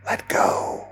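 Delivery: breathless whispered command; barely a whisper. Acted. Leader whispers to the man about to fall, barely a whisper. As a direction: breathe it with no voice at all. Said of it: "Barely a whisper".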